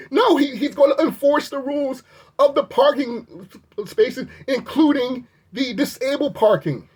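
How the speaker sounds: high, worked-up ranting; outraged disbelief. Real, not acted. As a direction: vent your outrage at him. Outraged rant about the attendant.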